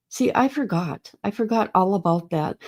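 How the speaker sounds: ordinary delivery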